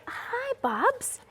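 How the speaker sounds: high-pitched pet-greeting coo. Real, not acted. Host greeting a collie on air, high baby talk. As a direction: gush over a beloved dog in a high voice.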